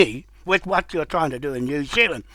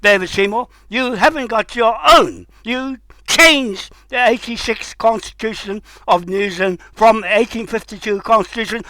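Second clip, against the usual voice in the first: ordinary delivery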